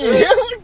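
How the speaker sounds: loud laugh. Real, not acted. Laughing at the bit.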